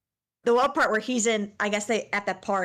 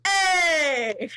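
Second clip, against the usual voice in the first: celebratory cheer; 7 semitones higher than usual. Real, not acted. Praised for rolling R's, celebratory cheer. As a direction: cheer yourself on.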